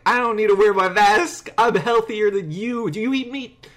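mock the narcissist vegan talking down to the worker; rude smug retort. Retelling his rude Chipotle rant to a friend, haughty and loud. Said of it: "I said something so rude".

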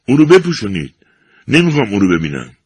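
loud distraught father's cry; shouting. A narrator's reading. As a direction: order them to cover him. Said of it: "پدر جانی با فریاد گفت".

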